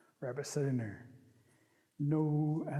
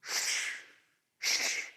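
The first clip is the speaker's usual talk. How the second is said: soft breathy shh, fading; whispered shush; 3 dB quieter, much slower than usual. Acted. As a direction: bullfrog hushes the room, whispered. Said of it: "Bullfrog takes it and goes".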